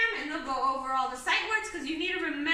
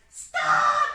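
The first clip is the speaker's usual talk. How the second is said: Stop! shouted much higher and louder; a sudden shout to halt someone; yelling; 12 semitones higher, 12 dB louder than usual. Shown as a demonstration. Teacher acting out an exclamation with a shout. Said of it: "Like they're yelling".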